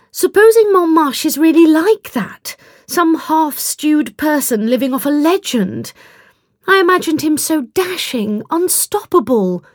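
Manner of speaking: high-pitched, disappointed heroine; disillusioned young woman. A narrator's reading.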